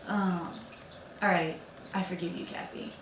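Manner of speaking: quiet, halting forgiveness; soft relenting. Acted. Suitor relenting on hearing her crying, soft forgiveness. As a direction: give in softly, trailing away.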